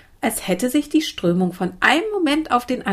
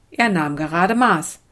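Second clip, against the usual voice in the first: ordinary delivery, nothing marked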